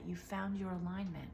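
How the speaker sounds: normal talking voice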